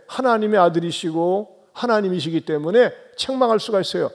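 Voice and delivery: ordinary delivery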